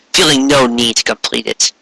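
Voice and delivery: plain reading voice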